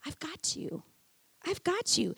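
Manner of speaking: soft, quiet reassurance; gentle whisper. Retold or imitated. God comforting weary pastor, soft reassurance. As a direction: breathe it tenderly. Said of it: "God was just whispering to me".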